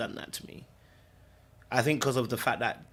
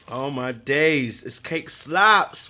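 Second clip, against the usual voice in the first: slow delighted verdict; 6 dB louder, much slower than usual. Real, not acted. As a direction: savour the bite with delight. Tasting delight.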